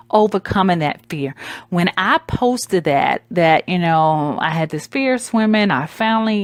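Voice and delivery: ordinary delivery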